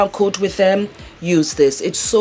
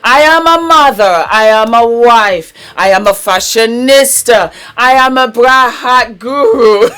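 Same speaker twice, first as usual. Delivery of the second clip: bubbly show host intro; 6 semitones higher, 7 dB louder than usual. Acted.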